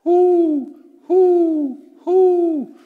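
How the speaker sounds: high, loud, long hoots; ringing high owl call. Shown as a demonstration. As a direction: hoot like an owl. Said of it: "He was an owl".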